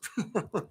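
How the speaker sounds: soft chuckling laugh. Real, not acted. Host realising it's five o'clock Friday, soft chuckle. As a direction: chuckle quietly to yourself.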